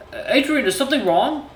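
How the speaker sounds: nervous, unsure little questions; stammering awkward suitor. Acted. Voices awkward Cecil.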